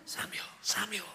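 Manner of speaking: hushed, eerie whisper of a name; whispered night call. Acted. God calling Samuel as he sleeps, whispered.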